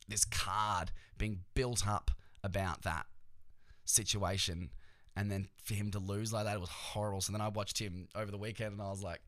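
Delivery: ordinary talk, nothing marked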